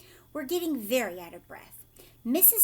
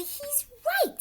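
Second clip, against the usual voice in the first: amazed Grandpa Joe; 5 dB louder than usual. A narrator's reading.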